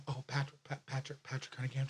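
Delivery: very soft, stumbling self-introduction; sheepish mumble. Retold or imitated. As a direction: mumble your own name, embarrassed. Retold sheepish giving of own name.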